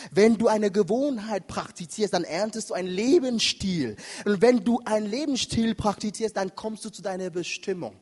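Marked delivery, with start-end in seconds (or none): none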